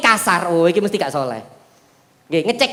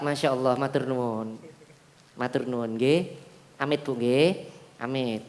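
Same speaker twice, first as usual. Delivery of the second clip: humble polite greeting; 11 semitones lower, 12 dB quieter than usual. Retold or imitated.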